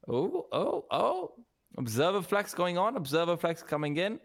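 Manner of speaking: rising excited oh oh oh; sudden caster excitement. Real, not acted. Casters react to sudden play.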